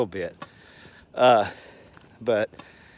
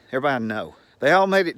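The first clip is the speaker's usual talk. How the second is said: normal talking voice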